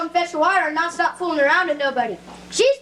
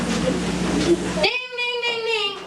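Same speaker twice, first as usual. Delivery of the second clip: imitating a bell; 5 semitones higher, much slower than usual. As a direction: play at being a steamboat bell. Boy imitates a steamboat bell.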